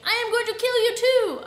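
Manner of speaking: high, loud villainous yell; full-voiced evil scream; screaming threat. A narrator's reading.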